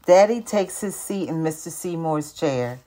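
usual speaking voice

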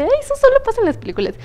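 high, fast mock gasp of disbelief. Telling her cohost how she spilled his drink, high fast mock disbelief. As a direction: squeal it like a movie cliché.

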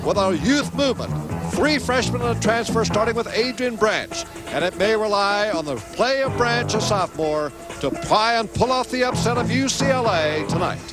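booming broadcast intro voice; TV sports promo read. Acted. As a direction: hype the matchup like a TV promo. Broadcast intro read over music.

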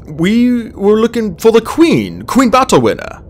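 high, slow, careful explanation; anxious, deliberate reply to a threat; nervous. A narrator's reading. Starflight nervously answers frightening dragon.

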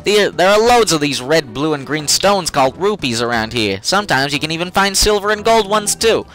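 lightly voiced Brooklyn mole; Brooklyn accent. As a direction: keep a hint of Brooklyn. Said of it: "Brooklyn accent".

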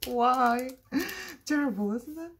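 high pretend sobbing with laughs; mock crying. Crafter mourns her butterfly, mock crying. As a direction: wail it in hammed-up misery. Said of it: "ready to cry".

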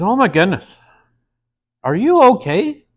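rising high, alarmed lady; mock-worried gasp climbing up; lightly hammed-up concern; concerned woman. Woman voiced in a joke.

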